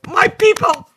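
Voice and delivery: excited cry. Retold or imitated. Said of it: "there's a part of me that's like".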